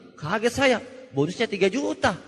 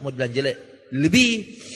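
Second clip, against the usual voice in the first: ordinary talk, nothing marked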